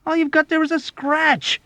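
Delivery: very high, loud scoff; disbelieving. A narrator's reading. Airman dismissing his friend's wound, loud disbelief. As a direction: scoff incredulously at the top of your range. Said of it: "exclaimed in disbelief".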